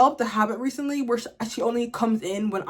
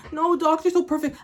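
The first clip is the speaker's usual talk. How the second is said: mocking dog lovers; 7 semitones higher than usual. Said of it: "People are gonna be like".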